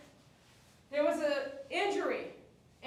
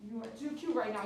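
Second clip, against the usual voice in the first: ordinary talk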